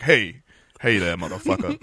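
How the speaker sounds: low, slow, slurred mock voice. S0 mocking S1's attempt, low and slow. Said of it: "sounds like a guy with Down syndrome".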